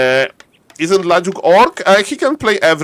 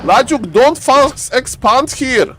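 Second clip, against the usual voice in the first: alarmed; 8 semitones higher than usual. Real, not acted. Caster alarmed at risky expand.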